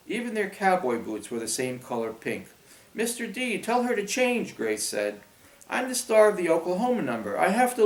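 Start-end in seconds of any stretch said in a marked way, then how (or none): 2.9-4.6 high-pitched, bossy demand; high demanding girl voice. Acted. Grace demanding to teacher. 5.7-7.8 loud, high and fast insistence; bossy loud girl. Acted. Grace insisting loudly.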